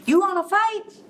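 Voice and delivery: high, pushy challenge; high-pitched cocky bug; picking a fight. A narrator's reading. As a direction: squeak out a cocky dare. Grouchy ladybug picks a fight.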